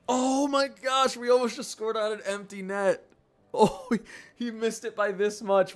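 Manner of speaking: gasping high-pitched thrill, drawn out; real excitement. Real, not acted. Streamer watching an empty-net chance, gasping excitedly. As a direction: yelp in disbelief at the near goal. Near goal in hockey game.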